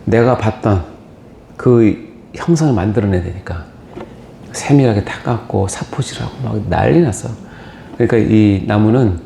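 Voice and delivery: ordinary talk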